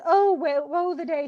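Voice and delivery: high, loud wail of woe; acted crying. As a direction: cry it out as the upset daughter. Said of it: "So she's still crying".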